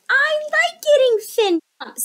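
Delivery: high, loud cheerful fish voice; happy puffer fish. Acted. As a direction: squeak it happily. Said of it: "said the fish".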